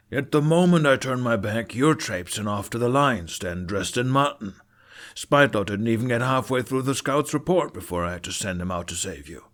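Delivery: loud, rising father's rant; angry scolding. Acted. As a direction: tear into the runaway son. Father scolding son loudly.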